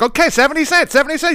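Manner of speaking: very high broken-English cashier. Retold or imitated. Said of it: "broken English as the lady did".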